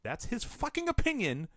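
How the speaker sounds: high-pitched exasperated rant; indignant. Real, not acted. Host ranting about a biased moderator, indignant. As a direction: spit out the outrage, pitch leaping up.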